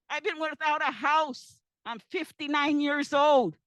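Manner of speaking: loud, slowing, voice pushed by real upset; real emotional outburst. Real, not acted. Pleading to the panel about having no house, loud and real. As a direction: cry it out to the panel, overwhelmed. Tearful plea at public hearing.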